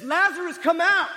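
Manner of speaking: high, loud command of full authority; commanding shout. Retold or imitated. Jesus calling Lazarus from the tomb, a loud commanding shout. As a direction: call the dead man out of the tomb. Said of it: "with a shout of raw, pure authority".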